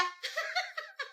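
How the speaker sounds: put-on shrill mean-girl laugh at her rival; a sneering burst of laughter; high mocking cackle. Bully mocks bajai driver father. (tawa mengejek bernada tinggi)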